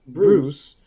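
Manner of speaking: usual speaking voice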